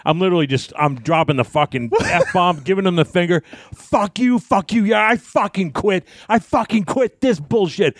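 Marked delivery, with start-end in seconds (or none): 3.7-8.0 high-pitched mock rant, slowing on the quitting; re-enacted furious yelling without full volume; scathing promo. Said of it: "I'm cutting this scathing promo".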